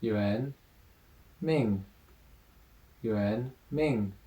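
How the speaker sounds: "Yuan" rising second tone, then "Ming"; rising tone model. Shown as a demonstration. Teacher models the rising tone in Yuan Ming for students. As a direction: say "Yuan" with a clear rise, then "Ming", then join them. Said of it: "Also second tone".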